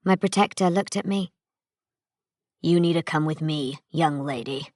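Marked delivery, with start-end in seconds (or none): 2.6-4.7 lower, rougher male stranger's voice; a hint of a hard-bitten veteran; gruff stranger. Narrator voices Nale.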